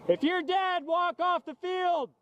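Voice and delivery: high, slow exasperated yell; cover me shout. Real, not acted. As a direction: bellow the order across the field. Yelling at players not leaving.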